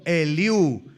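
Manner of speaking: normal talking voice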